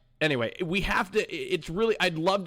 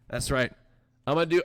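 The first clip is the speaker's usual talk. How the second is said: ordinary delivery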